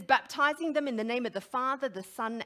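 loud, ringing read of the baptism verse; loud proclamation. Real, not acted. Preacher reads scripture aloud.

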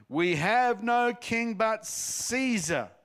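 high, loud, slow shout; yelled back. A narrator's reading. Priests shouting back at Pilate, loud and high. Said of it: "the crowd yelled back".

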